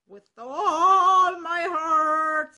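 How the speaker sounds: high, loud, slow rooster voice; a cock complaining about his mistress; indignant rooster. Acted. Cock accepts gladly.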